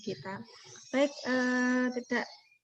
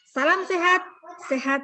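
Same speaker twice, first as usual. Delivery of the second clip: loud, high rallying call; cheerful shout; 8 semitones higher, 8 dB louder than usual. Real, not acted. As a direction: call it out brightly to everyone. Closing cheer of webinar.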